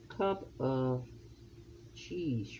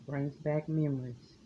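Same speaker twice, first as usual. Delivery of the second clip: ordinary talk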